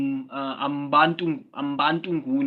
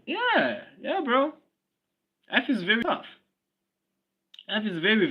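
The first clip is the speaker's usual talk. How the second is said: excited agreement; 9 semitones higher, 3 dB louder than usual. Real, not acted. Exclaiming agreement, voice jumps high.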